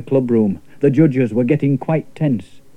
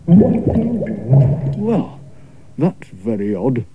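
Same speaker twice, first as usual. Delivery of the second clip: a bemused lad half to himself; Morris muttering; much slower than usual. Acted. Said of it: "muttered Morris".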